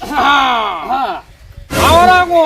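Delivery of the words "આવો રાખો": loud, higher greeting call; called-out welcome. Acted. Host greeting arriving visitors, calling out loudly. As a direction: call the guests in with raised voice.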